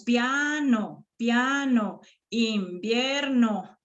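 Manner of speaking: slow, separated syllables; drawn-out teacher's syllabification. Shown as a demonstration. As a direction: sound out each syllable in turn. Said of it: "esas serían las sílabas".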